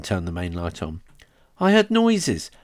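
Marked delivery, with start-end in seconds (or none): 1.6-2.5 scared. Acted. Scared boy, sister answers.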